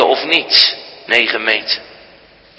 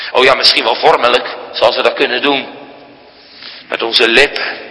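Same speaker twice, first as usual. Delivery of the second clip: normal talking voice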